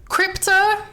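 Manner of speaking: lightly imitated hero calling his pet; calling the dog. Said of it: "Superman is like".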